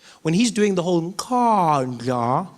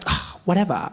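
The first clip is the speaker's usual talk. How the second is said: casual shrug of a whatever; breezy brush-off; dismissive whatever. Shown as a demonstration. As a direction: toss it off like you don't care. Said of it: "you're just like".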